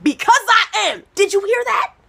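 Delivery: high, loud panicked yelp; paranoid panic. Retold or imitated. Comic replaying her paranoid answer to her boyfriend, panicked yelp. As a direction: jump at a noise that isn't there.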